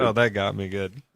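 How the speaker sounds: ordinary talk, nothing marked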